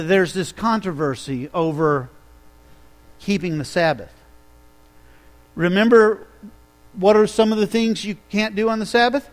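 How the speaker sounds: usual speaking voice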